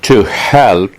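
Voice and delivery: ordinary talk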